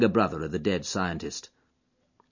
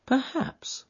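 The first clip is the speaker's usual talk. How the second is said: higher, softer musing; thoughtful; 3 dB quieter than usual. Acted. Holmes musing to Watson, thoughtful and soft. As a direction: weigh the word, thoughtfully. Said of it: "said Holmes, thoughtfully".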